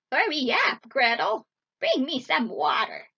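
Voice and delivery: loud, impatient witch voice; barked order. A narrator's reading. Witch orders the young girl to work, loud command. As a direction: order her sharply at full voice. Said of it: "she ordered the young girl".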